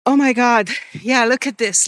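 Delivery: usual speaking voice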